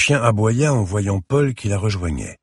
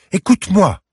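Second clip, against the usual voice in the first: high, loud pleading call of her name; urgent call; 14 semitones higher, 9 dB louder than usual. Acted. Mother calls out to her raging daughter, loud and urgent. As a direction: cut through her daughter's fury.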